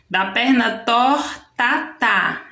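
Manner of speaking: "da perna torta" slowed, with "tá" echoed; "torta, tá" as a measured echo; repeated last syllable. Shown as a demonstration. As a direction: stretch "tor", then two beats on "ta, tá". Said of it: "repetir sempre a última sílaba".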